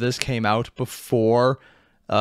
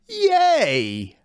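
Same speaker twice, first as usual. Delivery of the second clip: sarcastic yay; 12 semitones higher than usual. Acted. Sarcastic cheer after grim list.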